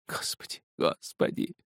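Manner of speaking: hushed, whispered plea; despairing whisper. A narrator's reading. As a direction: whisper it to God, broken. Broken man whispers to God. (отчаянным шёпотом)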